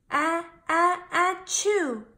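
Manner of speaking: a high, loud building sneeze, ah-ah-ah then a burst; big sneeze. Acted. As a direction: wind up and explode into a sneeze. Feathered hat tickles baby elephant.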